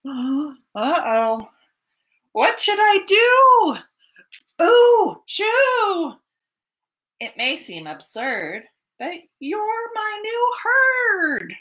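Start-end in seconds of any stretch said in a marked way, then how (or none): 0.1-6.1 much higher, louder gasp and shoo; hammed-up fright for the kids; mock panic. Porch sways under moose. 9.0-11.5 much higher, slow fond reply; lightly voiced moose; soppy moose.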